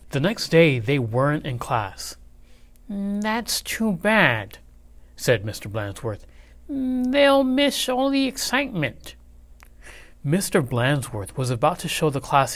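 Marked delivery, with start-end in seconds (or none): 2.9-4.6 slow, higher fussy teacher voice; a dreary teacher, drawn out; dull teacher. A narrator's reading. Blansworth voice. 6.7-9.1 much higher fussy teacher voice; a dreary teacher who thinks he's thrilling; dull teacher. A narrator's reading. Blansworth voice.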